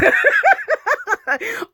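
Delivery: high delighted laugh; high giggle. Real, not acted. Laughing at her own excited listing, high giggle. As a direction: burst into a giggle.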